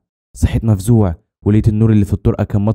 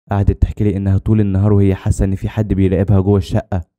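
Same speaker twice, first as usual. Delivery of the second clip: ordinary delivery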